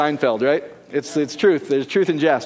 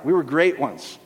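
usual speaking voice

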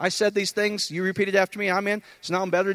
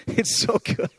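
laughing; 3 semitones higher, slower than usual. Real, not acted. Delighted laugh over point.

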